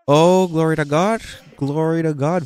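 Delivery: loud, high mock-pious praise; imitating an over-pious worship leader; very religious. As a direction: suddenly swell into showy church fervor. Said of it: "very religiously".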